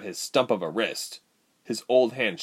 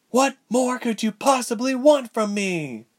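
high, furious heroine voice; outraged, word by word; 3 dB louder than usual. Acted. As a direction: hit each word like a blow. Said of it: "She enunciated each word with blows".

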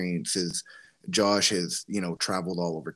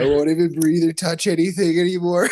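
high, wheezing words through laughter; laughing hard; 8 semitones higher, 7 dB louder than usual. Real, not acted. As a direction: talk while cracking up. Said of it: "I was laughing my fucking ass off".

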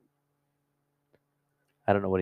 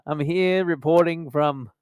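slow, loud, higher put-on reporter voice; mock TV reporter; 8 semitones higher, 6 dB louder, slower than usual. Joking St. Patrick's Day field report, slow hammed-up reporter. Jokey Irish reporter persona.

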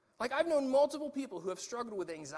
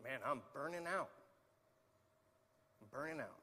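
burned-out admission; 4 semitones lower, 7 dB quieter than usual. Pretend. Voicing an exhausted servant.

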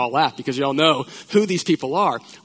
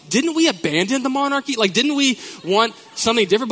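mock incredulous; 9 semitones higher, 5 dB louder than usual. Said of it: "And I'm like".

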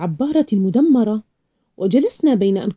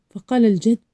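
usual speaking voice

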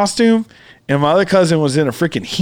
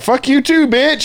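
mock angry retort; 8 semitones higher than usual. Acts drunk retort he wanted to give.

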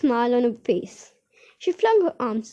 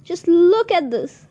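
excited; 6 semitones higher, 6 dB louder, slower than usual. Acted. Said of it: "she was shouting".

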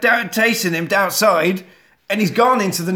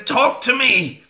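raised, demanding call; 7 semitones higher, slower than usual. Retold or imitated. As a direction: demand the doll answer. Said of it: "She says".

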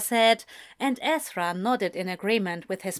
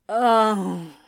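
loud drawn-out groan; one long, loud, narrated groan; 6 dB louder than usual. A narrator's reading. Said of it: "he groaned".

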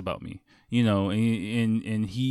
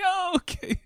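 very high, comic 'oh'; squeaky retold acknowledgement; mock falsetto reply. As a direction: imitate a reaction way up high. Acted reply, amid laughter.

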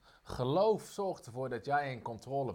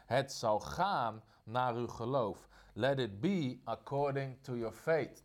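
solemn scripture reading; 3 semitones lower, slower than usual. Acted. Scripture read slowly.

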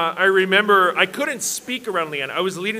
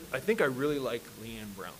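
low, much softer admission; sheepish confession; 6 semitones lower, 10 dB quieter than usual. Retold or imitated. Man confessing his crush while working out, sheepish and quiet. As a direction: drop the voice as if owning up shyly.

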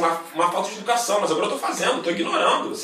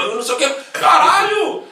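exasperated outburst; 9 semitones higher, 7 dB louder than usual. Real, not acted. Fed up with strangers online, bursting out loud. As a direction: shout it higher and louder.

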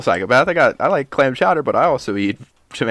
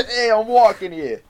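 slow, higher hammed-up Boston yell; Boston tough guy; 9 semitones higher, 4 dB louder than usual. Friend echoing the Boston bit, hammed-up Boston accent. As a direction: stretch it out like a cartoon Boston cabbie. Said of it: "Boston impression repeated".

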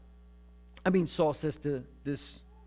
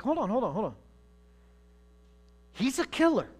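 king pleading; 6 semitones higher, faster than usual. Acted. King pleading with his commanders.

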